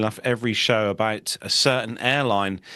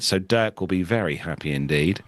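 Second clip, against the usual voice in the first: ordinary talk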